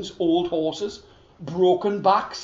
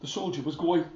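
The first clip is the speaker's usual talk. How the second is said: ordinary delivery, nothing marked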